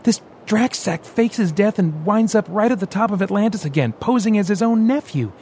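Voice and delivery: heated, rising rant. Acted. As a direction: let it boil over at the injustice. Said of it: "raising his voice".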